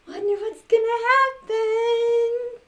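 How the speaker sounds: high, loud, slow sung wonder; high singsong anticipation; sing-song wonder. Acted. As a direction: draw out the suspense. Reader anticipating magic.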